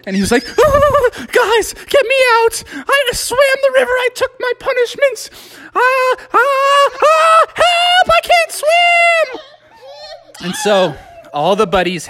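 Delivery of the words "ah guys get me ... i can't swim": very high, squealing mock panic; panicked cry for help. Smee begging friends to pull him out, panicked squeal. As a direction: yelp as if floundering in freezing water. Smee floundering in icy river.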